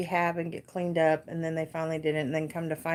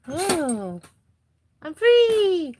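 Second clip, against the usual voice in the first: voicing the pet; 11 semitones higher, 7 dB louder, much slower than usual.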